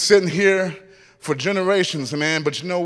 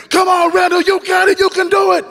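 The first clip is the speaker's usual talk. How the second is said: much higher and louder shouting; teammates screaming; 10 semitones higher, 3 dB louder than usual. Retold or imitated. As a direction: yell him on from the side. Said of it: "they're screaming".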